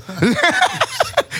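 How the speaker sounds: squealing laugh; high burst of laughter. Real, not acted. Laughing at his own punchline, high squeal. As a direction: crack up at your own joke.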